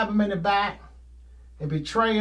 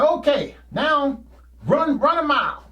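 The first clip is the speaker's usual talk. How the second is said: loud, high coach's command; coach barking; 6 semitones higher, 4 dB louder than usual. Retold or imitated. Coach ordering the runners to run a mile, loud bark. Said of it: "he said".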